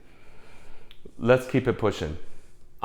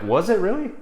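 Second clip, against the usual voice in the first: much higher, dopey was it really; surprised disbelief; 9 semitones higher than usual. Retold or imitated. Answering his ex who says he already met her, surprised. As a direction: caught out and baffled, played up. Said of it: "I'm like".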